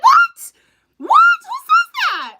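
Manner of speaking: very high, loud mock disbelief; incredulous squeal. Host retelling her reaction to a viewer, shrieking mock disbelief. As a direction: shriek it as if you can't believe it. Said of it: "I was like".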